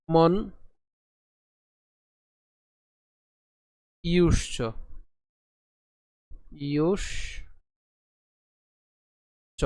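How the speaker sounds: word by word with long pauses; broken into parts. Shown as a demonstration. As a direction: split ইউষ from চ for learners. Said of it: "সুবিধার জন্য একটু ভেঙে দেই".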